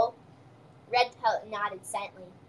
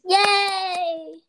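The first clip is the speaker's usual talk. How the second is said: cheering; 6 semitones higher, 5 dB louder than usual. Real, not acted. Cheering at story's end.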